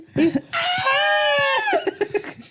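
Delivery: shrieking laugh-scream, very high; high-pitched scream. Retold or imitated. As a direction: let out a piercing high scream. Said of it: "He just fucking high-pitched scream".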